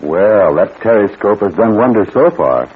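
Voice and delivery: ordinary talk, nothing marked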